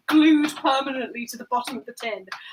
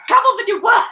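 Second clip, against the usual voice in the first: a sudden loud yell at the cake; real shout; 8 semitones higher, 11 dB louder, faster than usual. Real, not acted. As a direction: shout it out, high and loud, in frustration. Yelling at the stuck cake.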